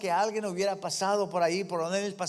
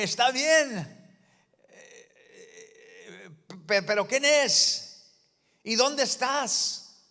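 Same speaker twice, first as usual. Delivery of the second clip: grito confundido; 6 semitones higher, 4 dB louder, slower than usual. Retold or imitated. Jack shouting, asking who.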